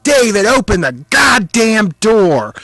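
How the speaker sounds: shouting over a rampaging cat; furious shout. Retold or imitated. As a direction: yell it at full volume, high and loud. Yelling at friend to open door.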